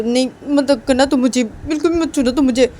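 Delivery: acted hurt outburst; upset refusal. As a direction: push away the hug with your voice. Refusing a hug in a quarrel.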